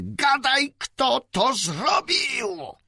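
high, loud screamed demand, last words slow; screaming. Acted. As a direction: scream it in her face. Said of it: "wrzasnęła".

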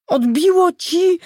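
very high, loud, drawn-out outcry; upset shout. A narrator's reading. Olga shouts at the driver who scared her, upset shout. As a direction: yell in outraged disbelief. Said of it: "krzyknęła zdenerwowana".

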